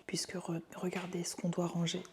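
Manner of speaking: soft unvoiced whisper. Real, not acted. Kids asleep, whispering.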